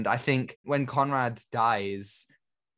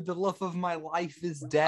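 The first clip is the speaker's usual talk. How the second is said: higher, hammed-up mourning; milk the tragedy, theatrical sorrow; mock grief; 6 semitones higher than usual. Co-host still parodying the widower, hammy mourning. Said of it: "I am showcasing emotion".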